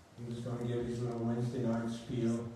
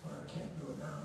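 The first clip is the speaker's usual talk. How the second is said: quiet protest; 3 semitones higher, 8 dB quieter, faster than usual. Retold or imitated. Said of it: "And so I said".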